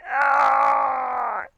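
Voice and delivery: loud, high drawn-out mock scream; imitated screaming car. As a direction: voice a car crying out in alarm. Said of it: "And my car is like".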